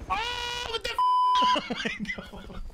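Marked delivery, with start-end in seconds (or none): 1.0-2.3 high shriek of laughter. Real, not acted. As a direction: burst into a squealing laugh. Shocked laugh at noise.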